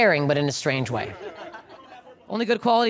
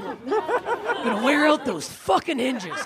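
slow, higher mock-angry scolding; exasperated dad; 5 semitones higher, much slower than usual. Dad complaining about the cupboard, slow mock exasperation. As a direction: drag out a father's exasperated complaint.

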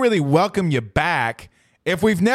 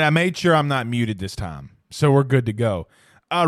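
normal talking voice

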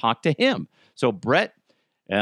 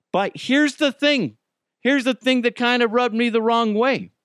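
dramatic setup; 11 semitones higher, 3 dB louder than usual. Real, not acted. Building up to a grievance.

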